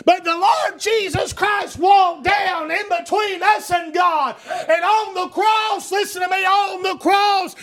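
high, impassioned preacher's delivery building to a shout; full-force preaching. Real, not acted. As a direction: drive the gospel home to the congregation.

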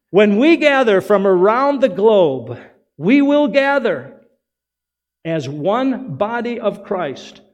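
loud, rising sermon climax, slow and stretched; preaching at full force. Acted. Pastor rallying the congregation to unity, loud slow preaching. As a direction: preach it out to the whole church, building.

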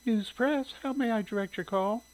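high, friendly receptionist voice; friendly female voice. A narrator's reading. Receptionist answering a newspaper's phone, friendly. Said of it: "a friendly female voice said".

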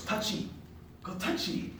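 high, soft broken-English coaxing; non-English-speaking tour guide. Retold or imitated. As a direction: lightly mimic a foreign guide's halting English. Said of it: "he didn't speak any English".

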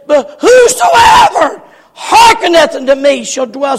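high, shouted scripture; preacher's ringing shout; impassioned proclamation. Acted. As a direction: burst out with the promise at full force. Proclaims God's last-verse offer.

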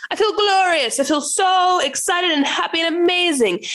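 high, bubbly parody of glowing joy; mock gushing. Mocking people who think action needs joy, gushing. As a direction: gush like an overexcited believer. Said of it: "people think aligned action is like".

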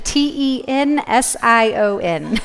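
slow letter-by-letter spelling. Shown as a demonstration. Spelling a misheard word for the audience, slow spelling out. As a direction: spell it out slowly, each letter separate. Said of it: "Yeah, sorry".

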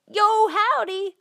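very high, loud drawn-out bird squawk; high-pitched parrot impersonation; trained talking bird. Acted. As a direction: stretch it out like a parrot greeting. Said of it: "birds that he'd trained to say clever things".

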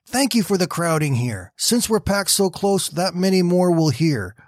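a lightly voiced fervent Betsy; grateful prayer. A narrator's reading. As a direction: pray with glad conviction.

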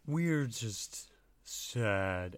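very slow gentle creature voice; slow sad creature. Acted. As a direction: drawl out each word sadly. Flump speaking.

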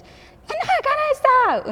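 high, loud mock mom yell; grito con ira. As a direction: re-enact screaming angrily at the kids. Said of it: "Un día les grité con ira". (grito agudo de mamá furiosa, imitado)

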